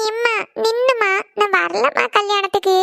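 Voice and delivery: slow, drawn-out pleading whine; whining plea. Acted. Daughter refusing the wedding trip to mother, whining plea. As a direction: plead with mother, stretching the words.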